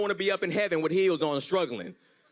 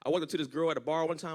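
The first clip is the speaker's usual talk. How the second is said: usual speaking voice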